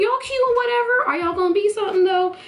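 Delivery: high, fast excited teasing; giddy high-pitched prodding. Retold or imitated. Excited acted reaction to couple.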